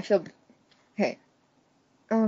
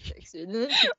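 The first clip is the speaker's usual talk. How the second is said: laughing; 2 semitones lower than usual. Real, not acted. Laughing repeat.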